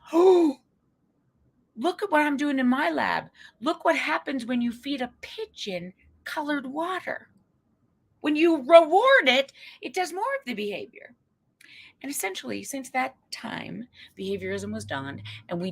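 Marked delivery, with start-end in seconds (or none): 0.1-11.2 higher, excited lab-man voice; mock excited scientist. Second psychologist brags about his lab, hammed-up excitement. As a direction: show off your pigeon trick, delighted. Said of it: "the other fellow said".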